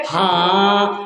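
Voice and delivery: low, drawn-out one-word answer; dim-witted groom. Acted. Son-in-law obediently answers his mother-in-law, dull drone. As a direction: drag the word out low and blank.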